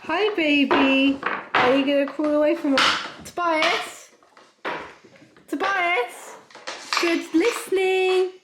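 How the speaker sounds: high, slow cooing praise. Real, not acted. Calling and praising the baby.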